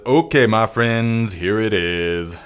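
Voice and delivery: slow, stretched-out catchphrase delivery; drawn-out catchphrase. Acted. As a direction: draw each word out like a show catchphrase. Reads out a viewer's catchphrase slogan.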